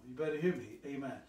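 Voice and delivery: usual speaking voice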